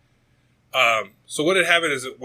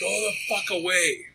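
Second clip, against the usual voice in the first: mock annoyed call; 8 semitones higher than usual. Sleeper to drunk guy at his door, mock annoyed call. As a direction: act out irritation without raising the volume.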